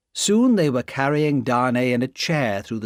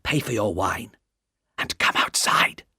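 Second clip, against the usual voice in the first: a spy urging his sister outside, in a frightened hush; low, frightened voice; 3 semitones lower than usual. A narrator's reading. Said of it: "in a low, frightened voice".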